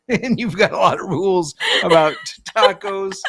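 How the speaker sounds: laughing, words spilling out; teasing through a laugh. Real, not acted.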